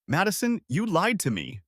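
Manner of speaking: usual speaking voice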